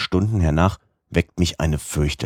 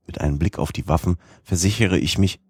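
ordinary delivery

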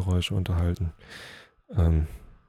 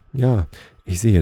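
louder opening on a narrated reply; mild call back; 3 semitones higher, 9 dB louder than usual. A narrator's reading. Dog answering the elk while running, mild call back. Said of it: "rief er".

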